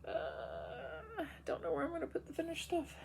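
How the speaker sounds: sniffly from allergies; talking with a sniffle; quick sniff. Real, not acted. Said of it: "Excuse my sniffing".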